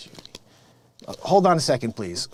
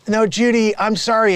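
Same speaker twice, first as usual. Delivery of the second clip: normal talking voice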